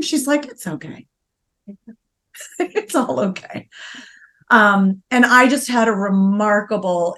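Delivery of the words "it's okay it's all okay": much softer, gentle soothing; calm a crying person tenderly; hushed imitation of a kind trainer; soft reassurance. Trainer comforting crying client.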